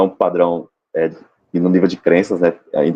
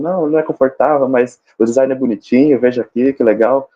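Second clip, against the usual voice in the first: higher-pitched mock pushy salesperson; insistent salesperson; 7 semitones higher than usual. Salesperson countering a customer's comfort objection, mock insistent. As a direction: push the product in a raised, insistent sales pitch. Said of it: "aquele vendedor que é insistente".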